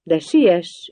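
lightly taunting; teasing. A narrator's reading. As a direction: needle them to hurry. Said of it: "ingerkedett vele a kalapos".

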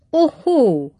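loud, high cry of surprise; surprised exclamation. A narrator's reading. Old master discovering the hidden suitor, surprised cry. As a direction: exclaim as if catching someone out. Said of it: "kiáltott fel Varl úr".

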